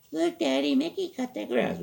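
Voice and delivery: very high-pitched mock girl voice; imitated daughter. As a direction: put on a daughter's high little voice calling to Daddy. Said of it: "they go".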